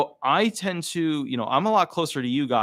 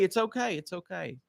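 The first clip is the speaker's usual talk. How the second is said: fading reassurance; 5 semitones higher, 6 dB quieter than usual. Acted. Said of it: "And the guy goes".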